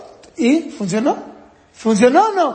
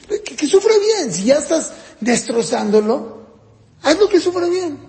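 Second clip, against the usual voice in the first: put-on higher, egging the kid on in sarcasm; mock encouragement; 5 semitones higher than usual. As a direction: cheer on the beating, ironically. Parent mock-urging a child on.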